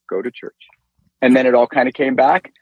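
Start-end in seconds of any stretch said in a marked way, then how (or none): 0.1-0.7 soft, even and unhurried, never raised; a gentle, settled command, like someone beside you; calm, nice voice. Retold or imitated. The voice on the bridge telling him to go to church, calm and soft. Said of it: "Not yelling, calm, not elevated voice, just a nice".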